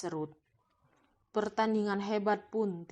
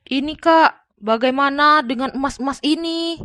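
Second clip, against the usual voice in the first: put-on higher, louder young man's voice; earnest Arya; 6 semitones higher, 15 dB louder than usual. Arya shows his wife the gold, higher and louder. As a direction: lift the pitch and project as the younger brother.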